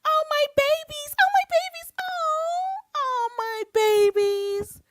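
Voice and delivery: very high, loud, syrupy mock of a woman cooing; over-the-top mimicry of a rival cooing over her fans; mocking sugary voice. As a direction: ham up a gushing lady, high and shrill-sweet. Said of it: "you mean that voice?".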